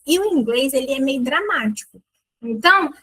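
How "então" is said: ordinary talk, nothing marked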